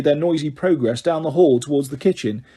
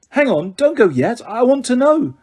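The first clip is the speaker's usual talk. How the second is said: higher, urgent plea; urgent pleading; 8 semitones higher, 3 dB louder than usual. Acted. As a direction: beg her to stay. Harry pleading.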